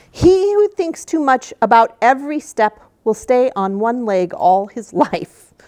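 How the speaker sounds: loud, emphatic delivery of a wise saying; proverb read-aloud. Acted. Reader shares a gnome's saying with children, emphatic read-aloud. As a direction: proclaim the proverb, slowing toward the end.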